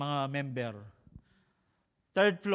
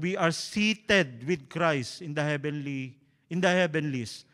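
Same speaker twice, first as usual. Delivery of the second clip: slow scripture read; 5 dB louder, slower than usual. Acted. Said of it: "Sa ibang translation".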